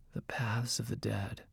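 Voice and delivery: hushed, ominous mage voice; whispering voice. A narrator's reading. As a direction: utter it very softly, like a dark secret. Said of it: "in his whispering voice".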